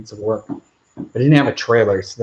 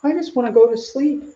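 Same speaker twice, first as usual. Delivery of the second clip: much higher, drowsy puppy voice; sleepy puppy voice; 12 semitones higher than usual. Pretend. Owner voicing his sleepy new puppy, high puppy voice. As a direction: voice the tired pup wanting a nap. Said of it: "like, already like".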